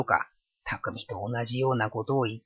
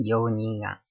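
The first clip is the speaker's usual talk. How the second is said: plain reading voice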